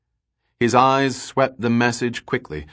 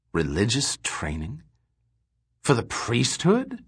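softer, disbelieving echo rising at the end; shocked disbelief; 2 semitones higher, 4 dB quieter than usual. A narrator's reading. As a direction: repeat it back in quiet shock. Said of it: "Gorm was profoundly shocked".

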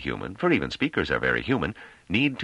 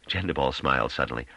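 ordinary delivery, nothing marked

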